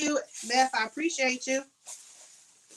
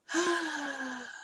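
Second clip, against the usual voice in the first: tired sigh; 4 semitones higher, 5 dB quieter than usual. Real, not acted. Tired after long live.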